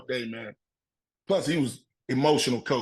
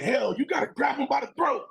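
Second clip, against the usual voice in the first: imitated coach's yell; 10 semitones higher than usual. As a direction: imitate a coach firing up the team. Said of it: "He was yelling, he's screaming".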